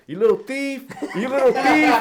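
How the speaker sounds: high, loud sing-song jeer through laughter; mocking taunt. Skater taunting the wax-stealing kid, laughing jeer. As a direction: shame the kid as you skate past.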